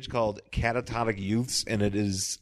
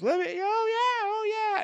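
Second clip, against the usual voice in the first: falsetto wail. Retold or imitated. Imitating [name] singing.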